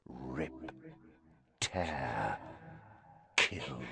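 very low, hushed menace; cold murderous voice. A narrator's reading. Disembodied voice Harry hears in the passageway, cold and murderous. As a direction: murmur it low, each word apart. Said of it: "the same cold, murderous voice".

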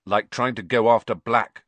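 sharp. A narrator's reading. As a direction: cut in firmly. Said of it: "said Ron sharply".

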